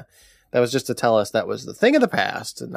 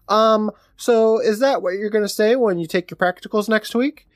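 mocking Martin voice; 9 semitones higher than usual.

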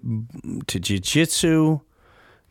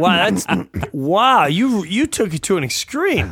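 higher and louder, open-mouthed wow; amazed; 6 semitones higher, 3 dB louder than usual. Real, not acted. Host marveling that the prank went to an extreme, amazed. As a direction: marvel at how far they went.

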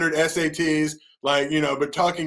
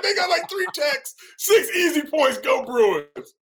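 high, laughing mock celebration; mock cheer; 12 semitones higher, slower than usual. Mocking cheer for rival school.

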